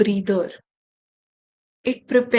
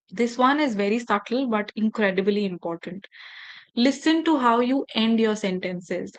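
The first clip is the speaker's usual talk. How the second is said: ordinary talk, nothing marked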